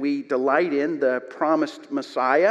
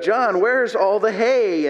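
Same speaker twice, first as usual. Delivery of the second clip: higher, louder, drawn-out call; shouted call; 6 semitones higher, 5 dB louder, slower than usual. Retold or imitated. Roosevelt hollering for the hay in the barn, shouted call. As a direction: call out slowly as if across a barn. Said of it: "And so he shouted out".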